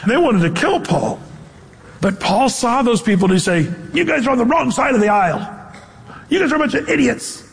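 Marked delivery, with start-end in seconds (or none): 3.9-7.4 higher-pitched imitated rant; mock scolding. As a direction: play-act telling people off. Acted scolding Paul did not give.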